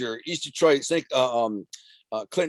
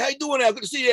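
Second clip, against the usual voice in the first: mock eager greeting; 12 semitones higher, faster than usual. Kids who now respect him greeting him, in a mock eager high voice. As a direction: ham up a fawning kid saying hi.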